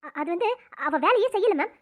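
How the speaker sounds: ordinary talk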